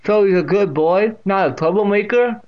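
high, loud deaf great-aunt's voice; Catherine. A narrator's reading. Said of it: "with that distinct pronunciation".

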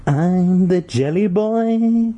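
high, slow, drawn-out creepy chant; weird sing-song. Acted. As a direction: sing it sweetly like a creepy child. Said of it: "he repeated".

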